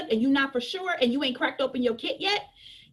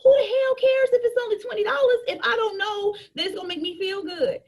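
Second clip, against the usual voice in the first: high, loud exasperated rant; heated outburst; 8 semitones higher, 3 dB louder than usual. Real, not acted. A coach scolding sellers who lead with price, loud and high. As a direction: blow up at the idea, pushing the pitch up.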